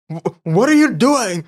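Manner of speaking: high, loud startled father; a put-on dad catching his daughter; shocked dad voice. Dad walks in on daughter, startled put-on voice. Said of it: "I can't give everybody that voice".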